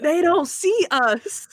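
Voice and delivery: slow, high, drawn-out retelling; emphatic clarification. Retold or imitated. Hobo explaining to a puzzled listener, slow mock-emphatic.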